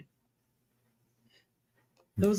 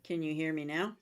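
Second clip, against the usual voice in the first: faint, mic too low; barely reaching the mic; barely audible; 3 semitones lower, 13 dB quieter than usual. Real, not acted. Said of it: "Can't hear you".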